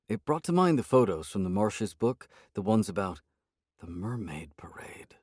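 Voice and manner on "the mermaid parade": soft, slow whisper; muttered whisper. A narrator's reading. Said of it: "I muttered".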